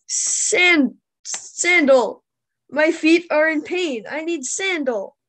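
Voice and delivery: high, loud mock German accent for Einstein; hammed-up Einstein complaining about his feet. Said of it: "because of his accent".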